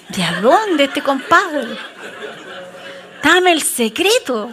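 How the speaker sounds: slow, drawn-out amused disbelief; mock incredulity. Onlooker eyes the lucky man, mock incredulity. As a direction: stretch it out in teasing wonder. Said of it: "tú lo miras y dices".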